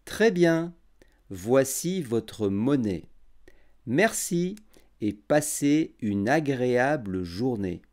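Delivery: every syllable clear and unhurried; over enunciating. Shown as a demonstration. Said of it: "j'articulerai bien et je parlerai lentement".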